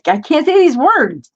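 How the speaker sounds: loud, high-pitched exasperation; real tongue-tied exasperation, pitch jumping up; exasperated. Real, not acted. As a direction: burst out in frustration at your own stumbling. Gives up stumbling over a word.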